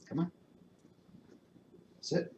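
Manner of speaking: ordinary talk, nothing marked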